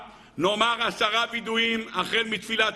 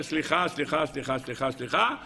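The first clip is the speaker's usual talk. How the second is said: low, mumbled string of empty apologies; perfunctory apology; 5 semitones lower than usual. Acted. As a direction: rattle off sorry by rote, dropping away in the middle. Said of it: "ככה אתה אומר". (סליחה אוטומטית וממולמלת)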